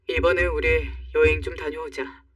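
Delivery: low-voiced dad making a proposal; father. Father proposes a trip to his daughter, low fatherly voice.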